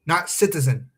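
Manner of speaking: normal talking voice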